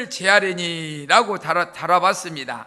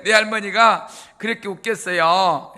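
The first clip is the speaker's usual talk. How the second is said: normal talking voice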